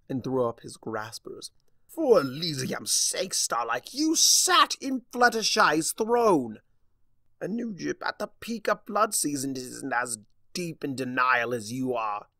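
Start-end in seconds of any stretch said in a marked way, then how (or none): 1.9-12.3 loud, high exasperated cry; exasperated outburst. Acted. Discord scolding Starlight for her denial, exasperated. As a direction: throw up your hands in disbelief.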